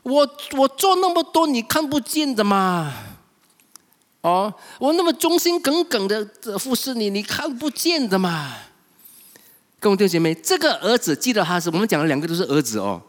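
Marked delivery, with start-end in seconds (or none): 0.0-8.5 aggrieved son protesting, raised voice; angry elder son. Retold or imitated. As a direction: vent years of resentment at father. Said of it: "所以他很生气的时候".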